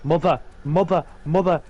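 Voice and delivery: put-on whiny Northern English baby demanding food; Northern accent. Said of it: "with my dreadful improvised Northern accent".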